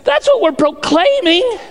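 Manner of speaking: high, driving proclamation; full-force preaching. Real, not acted. Preacher correcting how the room prays, full-force preaching. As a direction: rise to an emphatic peak.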